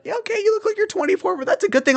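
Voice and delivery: very high mocking falsetto girl impression. College girls telling him he looks young, falsetto mock voice. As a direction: squeal it like a mocked college girl. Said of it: "They'll be like".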